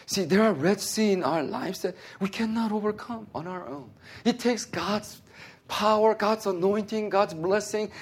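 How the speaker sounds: high-pitched, driving sermon delivery; fervent pulpit exhortation, pitched well up; impassioned preaching. Real, not acted. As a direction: preach it hard, building through the list of God's gifts. Preaches the point at full force.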